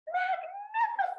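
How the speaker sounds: sharp high gasp of surprise; a quick astonished intake of breath. Real, not acted. Reader reacts to story.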